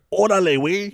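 loud, high Mexican Spanish greeting; Mexican slang call. Retold or imitated. Brother repeating the Mexican greeting, loud Mexican call. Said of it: "you'll hear".

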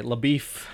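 LaBeouf as luh-BEEF; joke mispronunciation. Shown as a demonstration. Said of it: "that's what I call him".

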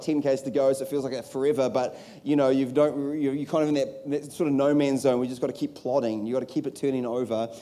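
usual speaking voice

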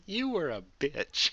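mocking the guy's dumb snappy reply; mock retort. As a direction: fire the line back like a cheesy eighties insult. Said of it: "And the guy goes".

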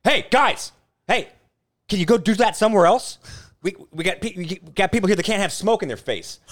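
high, loud shouted telling-off; imitated angry callout. Friend retells Chad confronting smokers outside the restaurant, imitated shouting. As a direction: holler at strangers across a patio in imitation. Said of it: "Chad literally turned around, and no joke, he's like".

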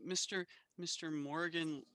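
normal talking voice

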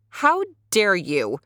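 put-on outraged girl scolding the porcupine; cried out angrily. A narrator's reading. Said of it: "Sapana cried to the porcupine".

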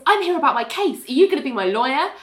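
high, eager, imitated client; excited. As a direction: bubble over like an overexcited client. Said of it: "Eric's really excited to see Ali".

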